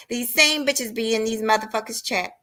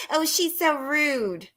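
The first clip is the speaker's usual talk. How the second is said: mocking chat voice; 5 semitones higher, 5 dB quieter, much slower than usual. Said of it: "who that was".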